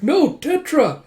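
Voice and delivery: high, loud, drawn-out scream of horror; anguished cry. Acted. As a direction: cry out to a friend being cut down. Nightmare of friend being killed.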